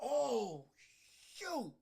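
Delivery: ordinary talk, nothing marked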